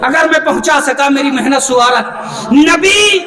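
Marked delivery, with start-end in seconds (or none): 2.5-3.2 ringing, emphatic pulpit declamation; forceful preaching. Acted. Preacher argues about the prophet's companions, forceful preaching. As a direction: hammer the point home to the congregation.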